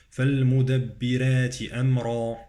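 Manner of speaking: full back vowel ending "أمرا"; light ra, heavy ra. Shown as a demonstration. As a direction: recite "أمرا" with dark r after thin r in "فالمدبرات". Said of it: "أمرا مفخمة".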